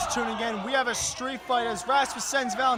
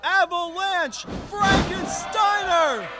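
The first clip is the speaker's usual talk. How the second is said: excited shout; 6 semitones higher, slower than usual. Real, not acted. Calling a big wrestling move.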